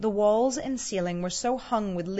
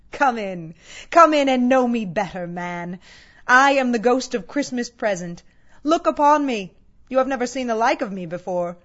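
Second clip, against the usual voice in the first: loud, hearty ghostly welcome; big commanding call, well above talking volume; booming giant; 3 semitones higher, 9 dB louder than usual. A narrator's reading. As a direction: boom it out like a giant host. Giant Ghost of Christmas Present hails Scrooge.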